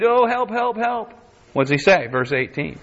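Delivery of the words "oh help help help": high-pitched, hammed-up cries for help; mock crying out. Preacher acting Israel crying through history, mock pleading. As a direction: plead like someone in labour pains. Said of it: "we're crying all throughout our history".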